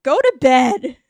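mock scold.

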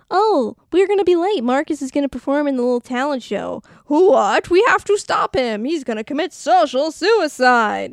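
high, loud, hammed-up alarm; mock panic. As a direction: gasp it out like a melodramatic emergency. Acting the sudden panic in film.

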